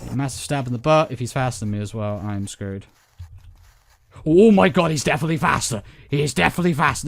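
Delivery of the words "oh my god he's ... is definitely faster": loud panicked outburst; being chased. Real, not acted. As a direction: panic as he closes in. Chased by faster monster.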